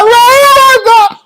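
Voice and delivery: long, loud, high-pitched wail; drawn-out hammed-up sobbing cry; mock crying. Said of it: "what the fuck you crying for".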